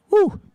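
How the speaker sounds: a sharp high cheer; high cheering whoop. Real, not acted. Cheering the riders on.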